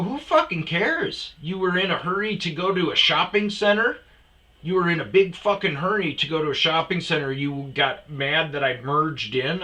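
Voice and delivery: loud, high, scornful comeback; angry retort. Retold or imitated. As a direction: throw it back at him heatedly. Retold quarrel with other driver.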